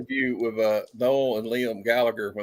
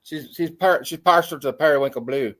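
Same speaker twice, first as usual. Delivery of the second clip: put-on a light Irish traveller accent, a little higher; Brad Pitt in Snatch; 5 semitones higher than usual. Still doing the Irish traveller accent from Snatch for friends. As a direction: keep the Irish traveller lilt going. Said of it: "talk exactly like Brad Pitt in Snatch".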